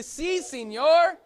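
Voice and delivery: si, senor called high and loud in a Spanish accent. Shown as a demonstration. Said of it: "good Spanish accent".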